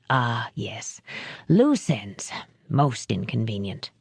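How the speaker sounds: low, soft, cold villain voice; a cultured killer murmuring; lightly voiced quiet menace; Finley's soft menace. A narrator's reading.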